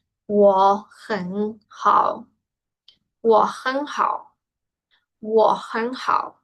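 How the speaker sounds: slow, drawn-out 我很好, syllable by syllable; pronunciation demo. Shown as a demonstration. Teacher showing students the tone change, slow pronunciation demo. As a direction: model 我很好 slowly, third tones shifting to second. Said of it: "la pronunciación".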